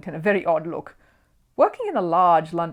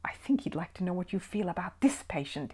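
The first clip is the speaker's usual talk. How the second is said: soft hissed whisper; urgent whisper to Paddington; 8 dB quieter than usual. A narrator's reading. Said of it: "hissed one of the other doctors".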